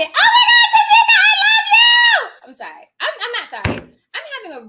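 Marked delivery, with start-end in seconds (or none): 0.0-2.5 very high, loud, laughing scream; real exuberant shriek. Real, not acted. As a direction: shriek with delight at a friend. Exuberant outburst to someone, then apologises.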